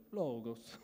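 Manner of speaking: low, drawn-out, slightly Italian accent; a playful hint of Italian accent. Shown as a demonstration. Pastor jokes about his own demo, slightly Italian accent. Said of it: "It's a bit Italian, just a little bit".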